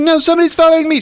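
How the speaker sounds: high-pitched hurried-sounding alarm; mock panic. Fleeing woman telling a cop, mock panic. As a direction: imitate a scared woman, voice way up.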